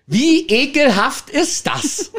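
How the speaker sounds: slow, high, laughing through the words; laughing disgust. Real, not acted. Attendant recalling the naked couple, laughing in disgust.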